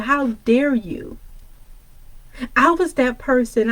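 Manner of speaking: ordinary delivery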